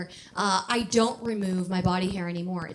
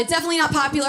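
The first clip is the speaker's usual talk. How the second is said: ordinary delivery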